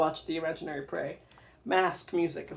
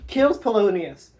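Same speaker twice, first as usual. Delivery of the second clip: normal talking voice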